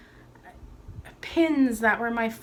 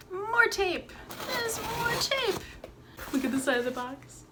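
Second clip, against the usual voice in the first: high singsong squeal; playful impatience; 11 semitones higher than usual. Real, not acted. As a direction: draw it out high, eager to get inside. Impatient to open taped box.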